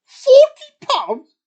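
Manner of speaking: loud, high, slow disbelief; shocked. Acted. Bear shocked at the GameCube price, loud and high. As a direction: gasp it out at the price, stretched.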